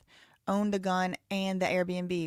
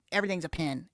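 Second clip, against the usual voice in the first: normal talking voice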